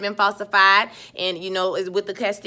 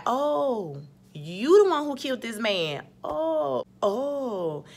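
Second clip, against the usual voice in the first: drawn-out, softer "oh" of dawning realization; mock realization; 3 semitones higher, 4 dB quieter, slower than usual. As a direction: act out the penny dropping, hushed and higher on the ohs. Acting shocked realization in recap.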